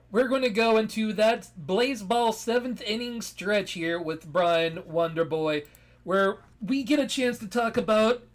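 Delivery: loud, pumped-up announcer hype; sports announcer. Acted. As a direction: bring in the segment like a ballpark announcer. Hypes a segment like a sportscaster.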